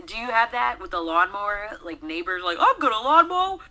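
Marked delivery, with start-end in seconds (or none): 2.6-3.6 high, loud mimicry of an oblivious neighbor; mock neighbor shout. Voice actor mimicking neighbor who mows during recording, mock shout. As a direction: holler it high like an inconsiderate neighbor.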